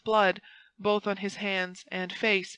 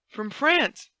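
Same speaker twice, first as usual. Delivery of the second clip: high, raised exclamation; crying out; 6 semitones higher, 5 dB louder than usual. A narrator's reading. Buckingham learns of the letter, crying out. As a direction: exclaim it, forgetting everything else. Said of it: "cried Buckingham".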